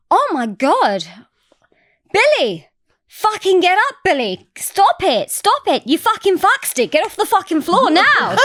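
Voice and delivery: high-pitched, exasperated scolding; flipping out. Retold or imitated. Banker orders Billy off the floor, flipping out. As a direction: imitate her losing it, each order pitched high. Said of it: "flipped out and she was like".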